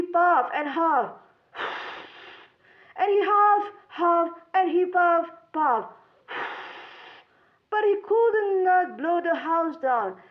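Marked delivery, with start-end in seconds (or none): none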